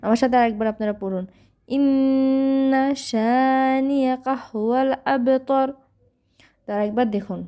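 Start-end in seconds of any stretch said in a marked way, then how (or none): 1.7-5.7 slow, drawn-out melodic recitation; tajweed recitation; Quran recitation. Shown as a demonstration. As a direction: chant each word long and even. Recites verse.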